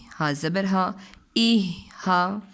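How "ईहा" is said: "ईहा" stretched very slowly and louder; very drawn-out demo. Shown as a demonstration. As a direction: hold "ईहा" long. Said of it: "next word है". ("ईहा" को बहुत लंबा खींचकर)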